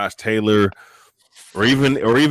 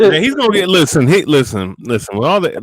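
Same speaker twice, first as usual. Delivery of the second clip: raised voice; 4 semitones higher, 8 dB louder than usual. Real, not acted. Heated boxing argument.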